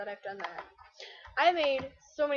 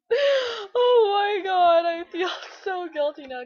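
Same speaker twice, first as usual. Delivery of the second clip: laughing through the words, louder and higher; guilty laughing; 8 semitones higher, 10 dB louder, slower than usual. Real, not acted. Laughing after the incense joke, guilty laughing. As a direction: crack up while confessing guilt.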